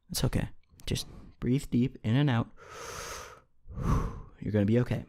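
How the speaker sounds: soft, reassuring apology to the listener; calm them down gently, breathe with them; soothing. Real, not acted. Soothing viewers after loud noise.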